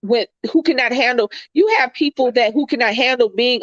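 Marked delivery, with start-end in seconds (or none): none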